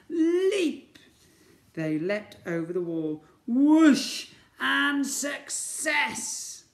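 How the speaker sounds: high, loud triumphant cry; excited leap. Acted. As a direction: cheer the big jump. Slog leaps over wall.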